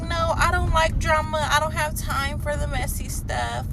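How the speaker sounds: high, drawn-out imitation of her excuses; put-on pleading, high and slow; mock protesting woman. Mimicking the other woman's excuses.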